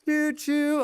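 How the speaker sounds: high-pitched "choo choo" toot; train whistle imitation. Streamer cheering on the hype train, high train-whistle imitation.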